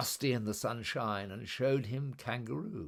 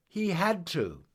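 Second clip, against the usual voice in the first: emphatic refrain; 8 semitones higher, 5 dB louder than usual. A narrator's reading. Narrator's refrain.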